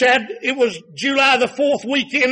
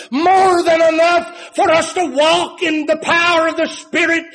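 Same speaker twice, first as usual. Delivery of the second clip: high, loud preaching cadence, hammering each "more"; full-force preaching; 6 semitones higher, 4 dB louder than usual. Acted. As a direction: preach it at full force, rising with every line. Preacher building to climax.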